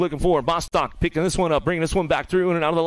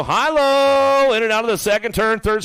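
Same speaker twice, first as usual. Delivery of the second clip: excited call; 6 semitones higher, 4 dB louder than usual. Real, not acted. Excited race call moment.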